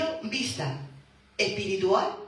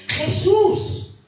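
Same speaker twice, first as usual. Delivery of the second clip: loud, high desperate cry for mercy; 7 semitones higher, 8 dB louder than usual. Acted. Blind beggar shouting to Jesus over the crowd, desperate loud plea. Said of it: "empezó a gritar".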